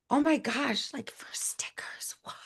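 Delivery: hushed, drawn-out disbelief; amazed whisper. Real, not acted. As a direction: whisper in awe at fancy packaging. Amazed at fancy sticker packaging.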